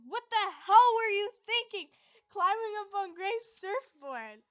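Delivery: exasperated loud telling-off; scolding. Acted. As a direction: tear into him for being reckless. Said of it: "I scolded him".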